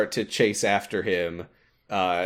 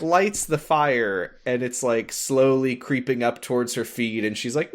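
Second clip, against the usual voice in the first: ordinary talk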